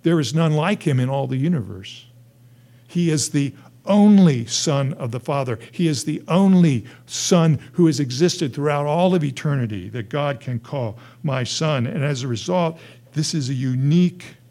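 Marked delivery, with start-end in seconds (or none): 2.9-9.2 "only" punched loud and high both times; insistent repeated weight on "only"; stress on only. Shown as a demonstration. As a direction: hammer the word "only", stretching it out. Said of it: "just to reiterate the point".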